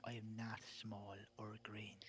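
slow, hushed mock denial; slow conspiratorial whisper. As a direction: whisper it slowly, deadpan. Denying being a leprechaun.

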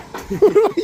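high, sudden laugh breaking out; a real high-pitched laugh; burst of laughter. Real, not acted. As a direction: laugh out loud at yourself. Laughs at his own story.

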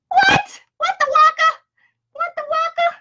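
very high, squealed disbelief; comic squeaky amazement, starting loud; playful astonishment. Pretend. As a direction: shriek it in silly mock shock. Mock amazement at the winner drawn.

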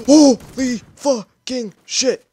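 stunned disbelief; shocked outburst. Real, not acted. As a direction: gamer shocked by a turn. Shock in game.

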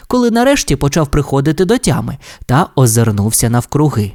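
ordinary delivery, nothing marked

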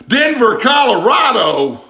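high, loud, stretched-out cry of disbelief; can't believe Louisiana sauce is from Denver; shocked disbelief. Real, not acted. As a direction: yelp it like you can't believe it. Hot sauce made outside Louisiana.

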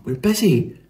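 a hint of a busy mum; lightly voiced mum. A narrator's reading. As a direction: brush them off, lightly acted. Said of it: "said Mum".

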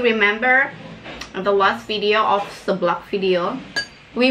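talking with food in mouth; words muffled by chewing; chewing while talking. Real, not acted. Seen 3: Puts greens in mouth at -1.0; pursed, full-looking mouth until about 2.9.

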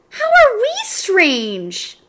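high, loud, drawn-out disbelief; indignant protest. A narrator's reading. Children object to Louis calling them strange, indignant. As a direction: stretch it out as an offended question.

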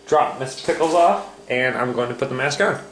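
usual speaking voice

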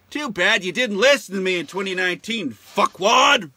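loud, scornful shout ending in an insult; told-you-so yell. Real, not acted. Ranting comic yells at people who ignored him, loud and scornful. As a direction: yell it at the ones who didn't listen.

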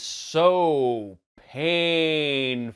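painfully slow, every word stretched out; exaggerated slowness. Shown as a demonstration. Critic mocking the sluggish action scenes, dragged out very slowly. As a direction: drag each word out endlessly, dropping away on the last.

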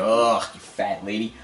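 usual speaking voice

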